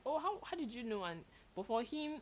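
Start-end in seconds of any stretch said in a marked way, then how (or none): 0.1-1.0 surprised. Retold or imitated. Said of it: "I'm like".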